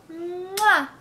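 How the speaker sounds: loud smacking kiss sound. Real, not acted. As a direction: blow a big playful kiss. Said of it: "Blow kisses".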